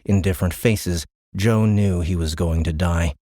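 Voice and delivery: ordinary delivery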